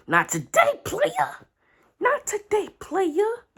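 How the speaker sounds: high, sing-song taunting quote; mock swagger. Fan mocking Kiku's earlier boast in a high sing-song. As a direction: throw her own boast back at her.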